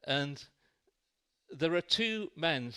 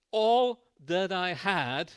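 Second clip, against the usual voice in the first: louder and slowed, leaning on each word; emphatic, slow; 3 semitones higher, 7 dB louder, much slower than usual. Acted. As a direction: press each word of the testimony. Paul's testimony climax.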